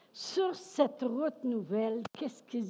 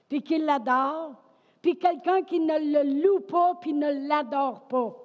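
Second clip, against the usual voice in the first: raised, louder preacher's voice; forceful preaching; 6 semitones higher, 7 dB louder than usual. Real, not acted. Preacher contrasts worshippers with those who don't, forceful preaching. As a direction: preach it out emphatically, pitched up.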